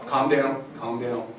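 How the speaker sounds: soft, hushed soothing. Shown as a demonstration. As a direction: talk a scared person down gently. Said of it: "Calm yourself down".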